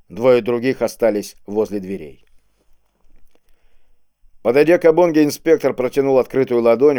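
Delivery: ordinary delivery, nothing marked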